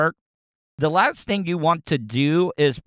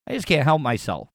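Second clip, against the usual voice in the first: normal talking voice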